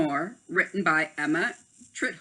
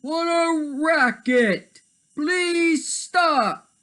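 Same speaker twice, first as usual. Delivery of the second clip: high, loud, slow complaint; high exasperated plea; annoyed animal; 10 semitones higher, 5 dB louder, much slower than usual. Acted.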